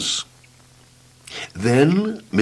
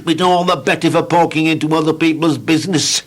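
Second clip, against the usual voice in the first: higher, amused nosy voice; Mister Nosy amused; 7 semitones higher than usual. Acted. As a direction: relish the nosiness.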